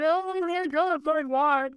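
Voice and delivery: high mock-tragic cry, heroine fainting; a mock Scarlett O'Hara lament; melodramatic wail. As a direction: ham up a swooning Southern belle sob. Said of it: "Like that".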